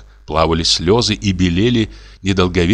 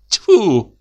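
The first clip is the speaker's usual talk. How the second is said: high, louder startled spitting; surprised spit; 13 semitones higher, 4 dB louder than usual. Acted. Raisa spits in surprise at her husband's accusation, higher and louder. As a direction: spit it out in disbelief. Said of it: "удивилась Араиса".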